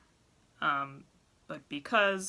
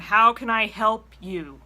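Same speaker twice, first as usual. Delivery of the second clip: slow, much louder, pointed repeat of the question; exasperated repeat; 5 semitones higher, 12 dB louder, slower than usual. Retold or imitated. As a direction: press the caller, clearly losing patience. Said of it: "I'm going".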